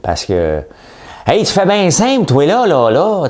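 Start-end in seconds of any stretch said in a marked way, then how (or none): 1.3-3.3 put-on high, loud sing-song Saguenay accent; mock the Saguenay sing-song accent. Mocking how Saguenay people talk, loud singing Saguenay accent. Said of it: "Vous parlez pas, vous chantez".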